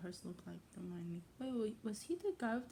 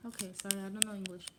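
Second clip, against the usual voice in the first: usual speaking voice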